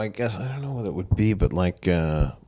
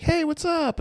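bright, very high chirpy hello; high-pitched greeting; slower than usual. Retold or imitated. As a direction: squeak it out sweetly. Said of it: "was like".

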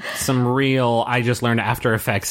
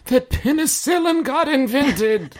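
high, slow put-on ghost character; May; 12 semitones higher than usual. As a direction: play it high and hammed-up. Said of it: "May's like".

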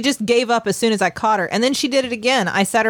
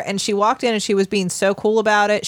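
ordinary talk

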